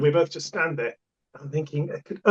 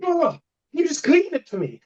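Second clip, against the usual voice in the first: much higher, airy put-on lady's voice; prissy lady; 12 semitones higher than usual. Lady asking the toilet man to clean her phone, high and prissy. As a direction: play the fussy customer, pitched way up. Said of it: "And she goes".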